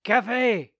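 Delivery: high, loud call; loud sudden cry; cried out. Acted. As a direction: call out for coffee. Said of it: "he cried".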